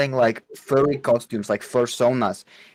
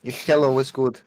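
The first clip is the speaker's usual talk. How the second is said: mock foreign accent greeting; Borat accent. Greeting doubting friends in a mock Borat accent. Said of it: "you're doing the Borat accent".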